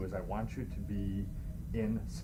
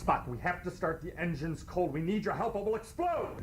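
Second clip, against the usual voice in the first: high, urgent pleading; urgent Kirk; 9 semitones higher than usual. Acted. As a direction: beg him before it blows. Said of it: "I'm gonna do Kirk".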